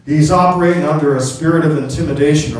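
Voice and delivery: ordinary talk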